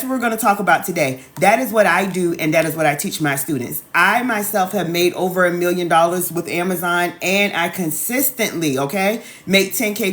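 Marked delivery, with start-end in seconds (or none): none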